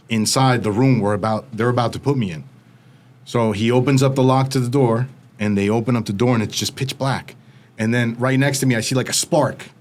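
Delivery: ordinary talk, nothing marked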